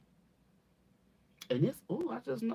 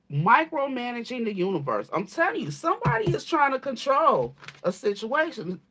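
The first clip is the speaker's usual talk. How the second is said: louder, insistent rush; louder and excited; 4 semitones higher, 8 dB louder than usual. Real, not acted. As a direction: push it out, caught up in the vision. Reader swept up by vision.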